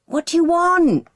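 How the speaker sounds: loud annoyed holler. A narrator's reading. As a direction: yell back down the stairs. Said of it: "he hollers in response".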